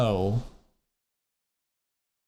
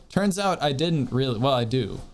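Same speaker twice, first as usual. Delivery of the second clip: ordinary delivery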